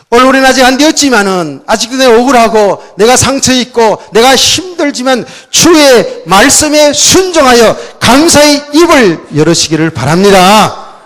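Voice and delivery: loud, high, urgent preaching; preaching at full force. Acted. Pastor urging the congregation, loud preaching.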